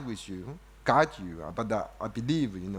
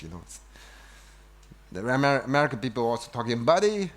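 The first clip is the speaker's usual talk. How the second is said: ordinary talk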